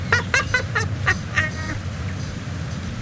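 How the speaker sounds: long, high-pitched laugh; real laughing fit. Real, not acted. Streamer cracks up at their own remark, high laughing.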